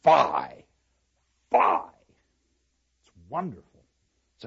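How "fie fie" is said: loud, separated exclamations; two emphatic stage cries; scornful Katharina. Acted. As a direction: turn on her with a sharp rebuke. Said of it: "Katharina turns on the widow and says".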